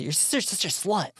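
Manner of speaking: put-on high, sneering teen brat; mock disgusted teen. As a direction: sneer it like a bratty kid brother. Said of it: "the way he says it".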